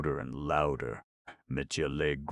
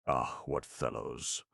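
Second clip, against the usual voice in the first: plain reading voice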